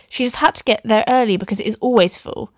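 usual speaking voice

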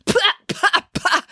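high, sputtered spitting noises; disgusted splutters; spluttering. A narrator's reading. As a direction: spit out foul water in bursts. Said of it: "as she spat out".

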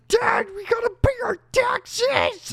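high, loud, slow whine; Brendan Fraser. Retold or imitated. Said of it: "It's very whiny".

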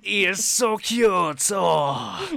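high, slow, loud coo; cooing baker. Acted. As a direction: melt over how cute he is. Baker cooing over the halfling.

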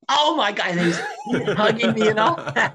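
high, loud joyful outburst; delighted exclamation with laughter in it; excited retelling. Retold or imitated. Co-author retelling the first hug, excited.

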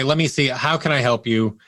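ordinary talk

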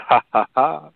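open-vowel ha ha ha laugh. Retold or imitated. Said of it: "It's, it's".

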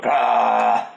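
short loud mock "Bah"; mocking bark. Player mocking the DM's roar with a short bark. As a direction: bark it out to tease the DM. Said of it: "that was just you going".

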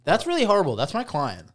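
high, loud mock-indignant protest; mock outrage. Coach protesting about her client, with hammed-up indignation. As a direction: squeal in fake horror.

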